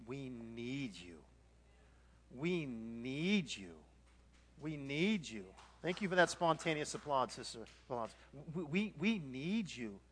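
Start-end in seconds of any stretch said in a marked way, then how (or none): 0.1-5.4 slow, lowered, heartfelt plea; gentle, unhurried pleading; earnest appeal. Real, not acted. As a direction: draw out each word tenderly to the congregation.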